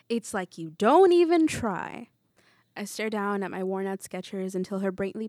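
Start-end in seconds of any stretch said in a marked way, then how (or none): 0.1-2.1 slow, loud exasperation; exasperated scolding. A narrator's reading. Teacher scolding her pupil in the hallway, exasperated. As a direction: drag it out with weary reproach, swelling loud.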